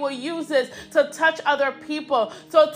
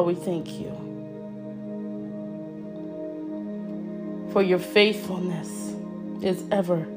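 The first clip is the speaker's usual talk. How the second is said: hushed prayer; 6 semitones lower, 7 dB quieter than usual. Real, not acted. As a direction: lower and softer, an intimate murmur of thanks. Hushed intimate thanks in prayer.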